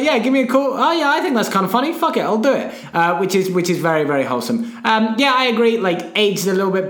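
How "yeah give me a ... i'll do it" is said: casual; breezy and carefree. Retold or imitated. Casual quote of Clooney.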